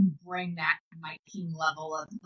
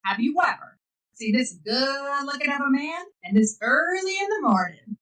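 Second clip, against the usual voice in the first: much higher, showy New York boast; proud dad boasting; 7 semitones higher than usual. Retold or imitated. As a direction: show off to your wife like a cocky king. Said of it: "and he goes".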